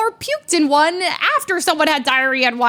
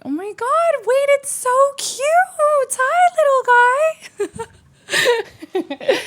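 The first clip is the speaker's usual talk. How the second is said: put-on very high, loud, slow squealing Valley Girl with laughter; imitating girls squealing over the tiny mole; 14 semitones higher, 3 dB louder, much slower than usual. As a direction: squeal at the cute little animal. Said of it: "I don't sound like that".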